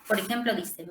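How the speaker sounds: ordinary talk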